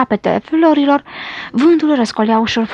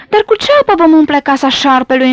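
usual speaking voice

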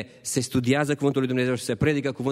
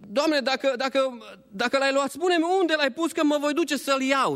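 high, halting, imploring plea; pleading Maria; 9 semitones higher than usual. Acted. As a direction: stumble over the words in grief. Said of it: "vorbește cu el și spune".

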